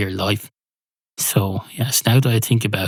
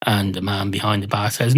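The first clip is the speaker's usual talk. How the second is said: usual speaking voice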